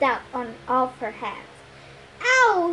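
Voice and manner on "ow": high yelp of pain; a sharp high "Ow!"; yelled. A narrator's reading. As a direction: yelp as if burned. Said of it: "he yelled".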